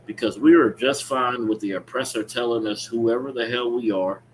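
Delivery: ordinary talk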